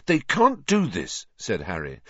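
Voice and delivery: high-pitched, urgent objection; indignant protest. A narrator's reading. Harry protesting the verdict to his friends, indignant.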